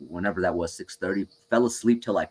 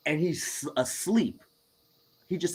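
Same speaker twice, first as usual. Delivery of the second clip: ordinary talk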